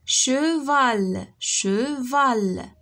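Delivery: "Cheval" split into che and val, both drawn out; syllable by syllable. Shown as a demonstration. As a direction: say "Cheval" in two long beats, che, val. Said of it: "Frappe les syllabes".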